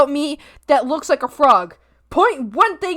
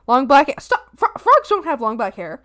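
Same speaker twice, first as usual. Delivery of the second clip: ordinary talk, nothing marked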